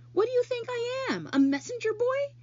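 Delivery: a put-upon rat complaining to the spider; grumbling rat. Acted. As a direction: Templeton grumbles at Charlotte's demands. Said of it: "grumbled the rat".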